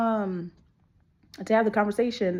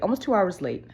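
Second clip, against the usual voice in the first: ordinary delivery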